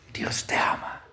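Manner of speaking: hushed, voiceless whisper to one person. Retold or imitated. Preacher re-enacting words to a possessed person, whispered. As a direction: whisper it very softly, close up.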